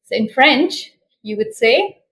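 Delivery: ordinary delivery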